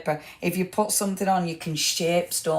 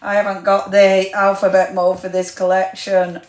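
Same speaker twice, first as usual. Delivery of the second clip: louder hammed-up lament; playful wail about missing out; mock dismay; 7 dB louder than usual. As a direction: whine it out as pretend tragedy. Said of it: "I'm going to cry in my soup".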